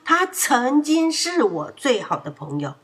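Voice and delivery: loud shout stressing 他; Polly shouts back at his mother. A narrator's reading. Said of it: "波利大喊着说". (大喊着说)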